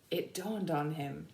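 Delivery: ordinary delivery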